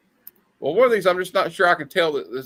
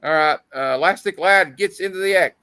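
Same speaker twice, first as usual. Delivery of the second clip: ordinary talk, nothing marked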